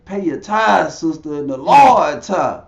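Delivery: loud mock pastor, punching TITHES and LORD; insistent preacher. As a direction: imitate a pastor pressing harder for money. Pastor insists over her objection.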